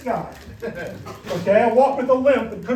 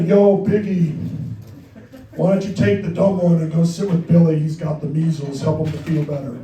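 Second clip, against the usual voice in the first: mom talking like an L.A. gangster; L.A. gang member mom; 6 semitones lower than usual. Retold or imitated. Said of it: "sounded like an L A gang member from the eighties".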